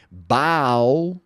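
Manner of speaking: Bow said slowly with ow. Shown as a demonstration. Teacher contrasting bow and ball. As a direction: stretch the ow vowel. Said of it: "Olha a diferença aqui".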